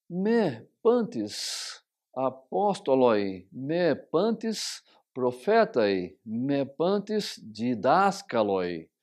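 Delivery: very slow Greek question; reading Greek aloud. Shown as a demonstration. Teacher reading Corinthians in Greek to students, slowly. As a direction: read each Greek phrase slowly. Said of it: "vamos tomar para o nosso estudo".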